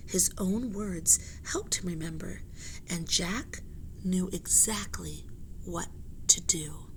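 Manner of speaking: soft whispered suspense. A narrator's reading. Narrator whispers the cliffhanger to listeners, softly. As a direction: whisper the secret.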